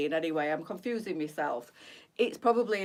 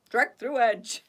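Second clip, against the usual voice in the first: Yorkshire phrase; 3 semitones higher, 6 dB louder, slower than usual. Shown as a demonstration. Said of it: "certain things that he picks up on".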